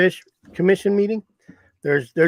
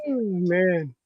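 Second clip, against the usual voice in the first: ordinary delivery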